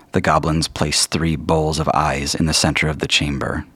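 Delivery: normal talking voice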